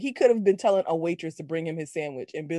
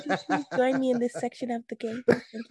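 softened, dainty invitation; mock politeness; 3 semitones higher, 4 dB quieter than usual. Co-host spoofing the catchphrase as a request, mock politeness. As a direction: parody a fighter being overly courteous.

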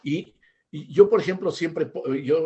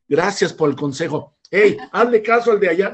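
mock coach reply; 5 semitones higher, 8 dB louder than usual. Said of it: "y va a decir".

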